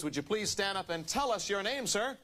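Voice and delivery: ordinary talk, nothing marked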